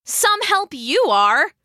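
high, scornful jab with swooping tune; spat out. A narrator's reading. Lydia to Galen as he dives away, spitting scorn. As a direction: throw it sarcastically at a useless friend. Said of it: "Lydia spat".

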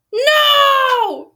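long, high-pitched wailed cries; dramatic wailing. Real, not acted. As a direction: wail it out in drawn-out dismay. Reader reacts to the story's turn.

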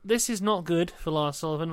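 ordinary talk, nothing marked

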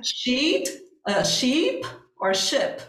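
slow "sheet" and "sheep" with long vowels, "ship" short; careful vowel contrast, slowed right down. Shown as a demonstration. Teacher switching to a safer example: slow, careful vowel contrast. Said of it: "o I longo e o I curto".

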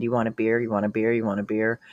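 low, soft mock offer to the friend; mimicking him. Imitating him offering friend beer.

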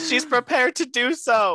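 high, slow diva delivery; Drag Race quote. Pretend. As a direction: deliver it like a reality-show diva. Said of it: "Drag Race references".

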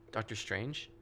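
ordinary talk, nothing marked

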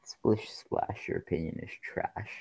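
whispered singsong taunt; teasing whisper. Pretend. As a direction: hiss the rhyme as a playful jab. Teasing rhyme at friend's opinion.

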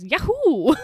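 high, loud imitated yahoo; Hammond's whoop. Podcaster quoting Hammond on the descent, loud high whoop. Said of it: "Richard Attenborough goes, yeah, or Hammond".

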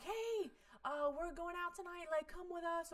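high, chirpy mock invite; mock friendly invite. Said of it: "If a girl's like".

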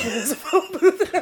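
high laughing repeat. Real, not acted. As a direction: say it through laughter.

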